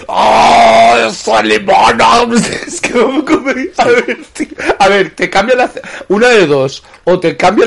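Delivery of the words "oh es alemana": mock German reveal. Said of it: "Y se lo quita".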